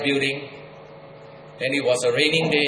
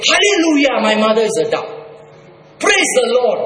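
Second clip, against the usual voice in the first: sarcastic hallelujah; 7 semitones higher than usual. Pretend. Preacher mocking empty praise, sarcastic and high. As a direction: ham up a fake praise, sarcastic. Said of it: "Am I supposed to go around and say".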